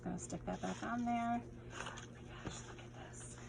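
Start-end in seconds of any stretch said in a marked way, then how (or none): 1.4-3.4 breathy awed whisper. Real, not acted. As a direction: hush it out like a secret treasure. Opening happy mail, hushed awe.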